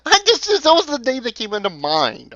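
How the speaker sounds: high, laughing protest; helpless high-pitched laugh, settling as you go; laughing defensively. Real, not acted. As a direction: squeak it out through real laughter. Laughing while defending the name choice.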